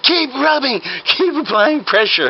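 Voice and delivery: high, drawn-out urging; insistent fake doctor. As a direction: urge the helpers on with mock urgency. Fake doctor urges helpers on.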